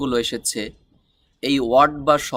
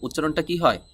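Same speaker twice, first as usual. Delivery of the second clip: ordinary delivery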